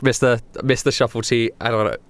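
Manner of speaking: ordinary delivery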